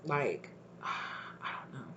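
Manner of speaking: sighing, whispered, slow; resigned whisper. Real, not acted. Tarot reader trailing off after her advice, whispered and resigned. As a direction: give up on the thought under your breath.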